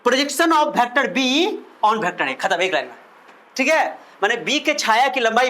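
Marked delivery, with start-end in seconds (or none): 0.1-2.4 very slow, word by word; slow dictation. Shown as a demonstration. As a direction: dictate the term to the class. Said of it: "इसको बोलते हैं, आप सुनो".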